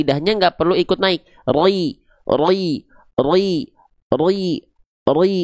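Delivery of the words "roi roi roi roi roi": roi said thick, rounded and heavy. Shown as a demonstration. Teacher demonstrating the wrong thick ro on kasroh. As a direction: say roi with the back of the tongue raised. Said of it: "nggak perlu gitu".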